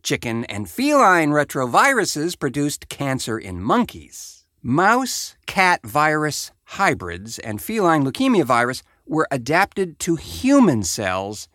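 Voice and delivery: ordinary talk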